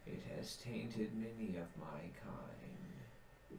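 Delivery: slow breathless whisper; whispered warning. Acted. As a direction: warn them in a hushed whisper. Treant whisper.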